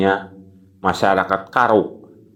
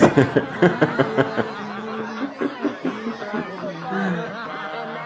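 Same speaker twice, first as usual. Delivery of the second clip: high laugh; 11 semitones higher, 8 dB quieter than usual. Real, not acted. Laughs after hot mouthful.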